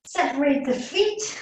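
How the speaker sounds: Yashu impression. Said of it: "I'll stop doing Yasu's voice".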